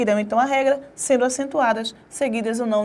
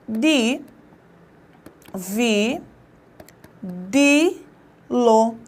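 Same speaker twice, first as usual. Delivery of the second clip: "di, vi, di, lo" split into separate syllables with long pauses; much slower than usual. Shown as a demonstration. Teacher splitting a second word for students, slow separate syllables. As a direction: spell out dividi-lo one beat at a time.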